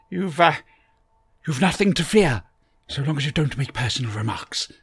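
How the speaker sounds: eccentric Doctor voice. A narrator's reading. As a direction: bustle in as a fussy, kindly eccentric.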